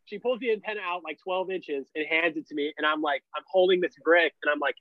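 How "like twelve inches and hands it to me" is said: usual speaking voice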